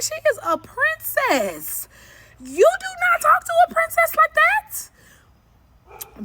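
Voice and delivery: slow, very high drawn-out scolding; hammed-up outrage, word by word; exaggerated indignation. Retold defence of her niece.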